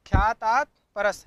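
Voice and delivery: ख्यातात परस called out loud, ख्यातात hit hardest; loud sutra recital. Shown as a demonstration. Teacher recites the rule aloud for students, loud. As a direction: chant the sutra out loud for the class. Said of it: "क्या सूत्र है?".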